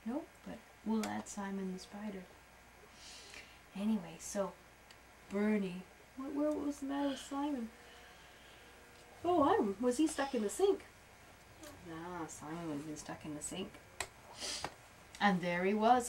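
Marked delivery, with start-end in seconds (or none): none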